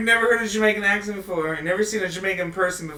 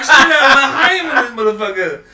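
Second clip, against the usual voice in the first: louder, higher and slow through laughter; laughing; 6 semitones higher, 12 dB louder, slower than usual. Real, not acted. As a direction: stretch it out while cracking up. Laughing at own joke.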